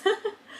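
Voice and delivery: real laugh. Real, not acted. Laughing at the joke.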